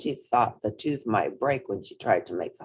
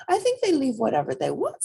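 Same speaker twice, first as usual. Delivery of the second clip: put-on high childlike voice; little rabbit; 11 semitones higher, 4 dB louder than usual. A narrator's reading. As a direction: speak up brightly like a small child.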